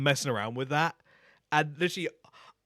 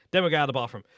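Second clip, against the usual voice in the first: ordinary talk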